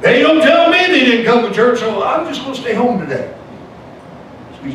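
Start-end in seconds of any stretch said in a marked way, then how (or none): 0.0-3.3 high-pitched mock grumble, easing down at the end; hammed-up protest; acted-out complaint. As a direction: imitate yourself griping to your wife. Acting out his own complaint.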